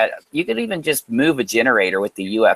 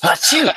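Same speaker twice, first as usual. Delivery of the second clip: sneezing; 3 dB louder than usual. Real, not acted. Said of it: "I'm sneezing or something".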